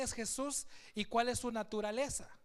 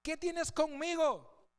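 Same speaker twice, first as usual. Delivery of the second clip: slow, high, drawn-out cry; 7 semitones higher, slower than usual. Acted. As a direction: call out the question slowly and raised. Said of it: "exclamó a gran voz".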